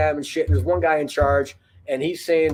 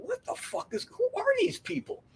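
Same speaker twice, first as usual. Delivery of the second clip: high, breathless disbelief, much softer; stunned disbelief; 9 dB quieter than usual. Retold or imitated. Marine watching the craft, stunned high whisper-like disbelief. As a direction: gasp it out in shock. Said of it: "he's thinking".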